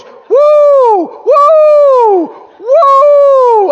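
long, high, loud "woo"; piercing preacher's whoop, high and sustained; imitated holler. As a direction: holler drawn-out whoops at full volume. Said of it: "him hollering".

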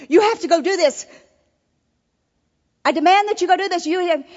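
loud, high-pitched mock yelling at staff; yelled order. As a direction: bark it out as a demanding boss would. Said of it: "I don't have to yell at our staff".

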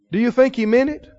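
ordinary talk, nothing marked

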